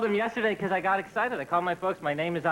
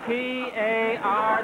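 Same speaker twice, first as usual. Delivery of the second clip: slowly spelling; 4 semitones higher, much slower than usual. Retold or imitated. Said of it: "My brother's going".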